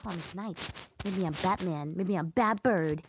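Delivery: usual speaking voice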